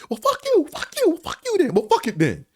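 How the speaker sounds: very high, loud mock-furious cursing; imitating grandma ending the fight. Grandma ending the argument with her son, very high and loud. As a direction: slam the door on the argument. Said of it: "the way they ended the argument was".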